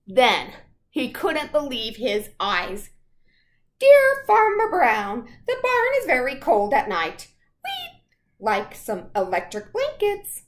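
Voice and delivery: loud, lively storybook read; picture book read to children. Acted. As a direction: read aloud to the class with gusto. Reads picture book aloud.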